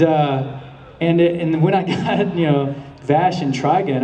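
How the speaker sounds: normal talking voice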